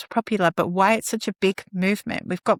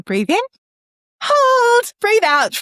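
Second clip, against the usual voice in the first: "hold" lifted much higher and louder, "breathe out" falling; lilting, louder breath chant; sing-song mantra; 7 dB louder, slower than usual. Shown as a demonstration. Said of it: "you could even do it like that".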